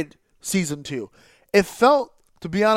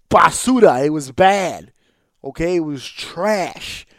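usual speaking voice

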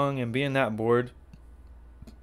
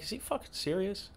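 hushed aside; 7 semitones higher, 11 dB quieter than usual. Retold or imitated. Aside to Sarah about driver.

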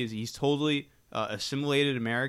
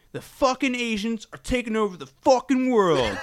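mock angry shout, high and loud; hammed-up furious outburst; retold yelling; 8 semitones higher, 6 dB louder than usual. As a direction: imitate an irate man yelling as he leaves. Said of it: "He was yelling".

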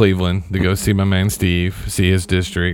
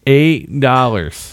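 EIGHT and dollars stretched out very slowly; incredulous emphasis; 3 semitones higher, much slower than usual. Real, not acted. Storyteller stressing the tiny sum to cohosts, incredulous emphasis. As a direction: draw out "Eight dollars" in disbelief. Repeats the trivial sum in disbelief.

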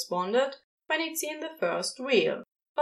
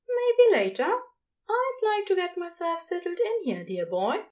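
Aziraphale voice; 7 semitones higher than usual. A narrator's reading. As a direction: play the prim angel, pitched up.

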